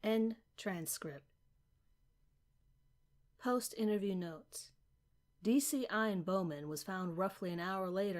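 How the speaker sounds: soft, even, official read; formal report reading. Acted. Narrator reading the post-interview notes, soft and official. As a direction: read the case notes dryly.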